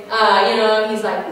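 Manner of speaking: ordinary talk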